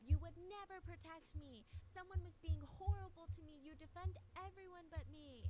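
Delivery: high, loud mock scream of a furious girlfriend; retold screaming. Girlfriend screaming at her boyfriend in public, loud mock scream. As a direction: shout it at him like she feels abandoned. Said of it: "full on screaming at him".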